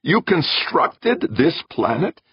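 slow, high incredulous question; incredulous and drawn out; obvious disbelief. A narrator's reading. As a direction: spell out your disbelief, voice pitched high. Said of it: "Meek asked in obvious disbelief".

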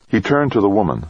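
ordinary delivery

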